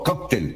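"Cóctel" said KOK-tel; "Cóctel" falling away after KOK; stress on O. Shown as a demonstration. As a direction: hit the first syllable of "Cóctel". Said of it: "con tilde en la O".

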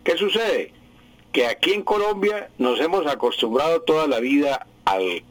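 ordinary talk, nothing marked

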